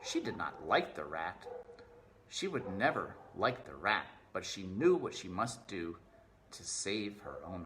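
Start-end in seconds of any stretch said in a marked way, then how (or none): none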